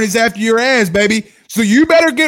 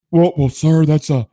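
Kevin Warren impression; 6 semitones lower than usual. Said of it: "my best Kevin Warren impression".

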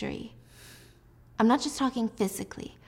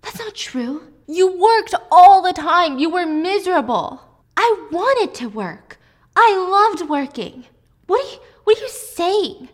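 heated shouting match; 10 semitones higher, 8 dB louder than usual. Acted. Alice and Jack shouting at each other, furious. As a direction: argue like it's life or death. Acted quarrel, shouted back and forth.

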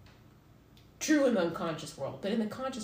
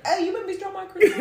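much higher and louder, whiny; Vine guy squeal; 13 semitones higher, 13 dB louder than usual. Retold or imitated. Imitating the pushed Vine guy losing his croissant, high and loud. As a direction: squeal like your pastry just fell. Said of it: "and he's like".

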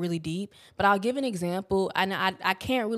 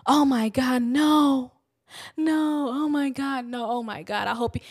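higher, alarmed mother begging no; panicked mom; 4 semitones higher than usual. Retold or imitated. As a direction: imitate a frightened mom's repeated protest. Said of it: "She was just like".

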